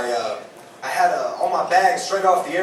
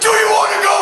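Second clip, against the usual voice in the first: high, loud mock challenge from a pumped-up fan; aggressive fan; 13 semitones higher, 4 dB louder, faster than usual. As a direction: bark it like a drunk football fan itching to fight. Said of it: "He goes".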